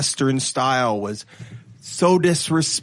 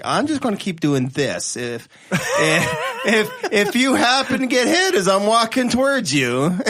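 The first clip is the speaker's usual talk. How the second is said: higher-pitched mock cartoon threat, laughing; imitating Simpsons cartoon; 7 semitones higher, 3 dB louder than usual. Retelling a Simpsons gag to co-hosts, imitating cartoon threat. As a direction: play a silly cartoon warning, rising as you go. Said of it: "they do it on Simpsons".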